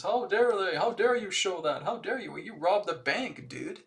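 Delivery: high, loud outraged whine; hammed-up indignant yell; mock screaming robber. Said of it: "screaming at the top of his lungs".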